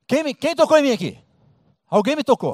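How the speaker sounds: pergunta mais alta e forte; Jesus chamando. Acted. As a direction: insista alto na multidão. (Jesus chamando alto na multidão)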